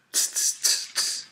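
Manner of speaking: monkey tsk noises. A narrator's reading. Monkeys mocking the furious peddler, voiceless tss noises. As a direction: run of voiceless tss sounds. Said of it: "monkeys only stamped both of their feet back at him and said".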